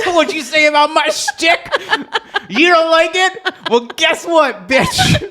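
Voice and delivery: high, loud diva voice, laughing; [name] voice. Pretend. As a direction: camp diva sass like [name]. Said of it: "Is she [name] now?".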